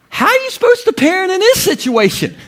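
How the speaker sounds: high-pitched, exasperated disbelief; baffled parent. Retold or imitated. Dad after the hamster incident, acted high-pitched bafflement. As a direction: voice shoots up, acting at a loss.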